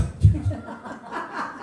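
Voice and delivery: a high breathy burst of laughter; high airy laugh. Real, not acted. Preacher laughs at her own bitter punchline, high and airy. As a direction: laugh at the joke just told.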